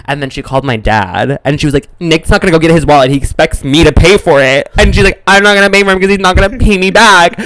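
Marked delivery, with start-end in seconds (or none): 2.0-4.7 slightly higher, quick complaint; retold freaking out. Sister complaining to dad about the wallet, mildly freaking out. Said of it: "freaking out". 5.3-7.4 higher, louder mock tirade; retold freaking out. Sister phoning dad to complain, mock freak-out. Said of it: "freaking out".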